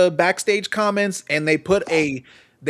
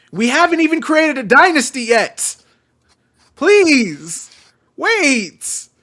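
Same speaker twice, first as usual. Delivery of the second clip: mock desperate plea; 5 semitones higher, 4 dB louder than usual. Acted plea to Ospreay.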